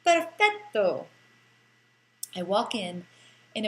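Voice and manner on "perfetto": high, loud, slow burst of Italian delight; delighted exclamation. Acted. Tourist spots a Bohemian pizzeria, thrilled exclamation. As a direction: savour it like a perfect find.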